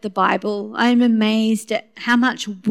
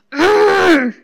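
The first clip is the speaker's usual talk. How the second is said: loud, high exasperated growl of frustration; frustrated groan; 9 semitones higher, 6 dB louder than usual. Shown as a demonstration. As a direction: let out a wordless frustrated groan. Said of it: "I'll say something like".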